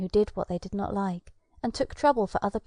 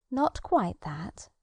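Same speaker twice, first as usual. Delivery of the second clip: calm fairy; much slower than usual. Acted. Fairy answers calmly.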